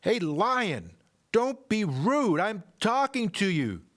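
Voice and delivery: put-on boy yelling at a lion who ignores him; screaming boy. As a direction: scold the lion like a cross kid. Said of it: "the boy screamed".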